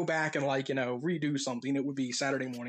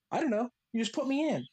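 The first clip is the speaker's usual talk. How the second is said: put-on little girl voice; 8 semitones higher than usual. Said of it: "she goes".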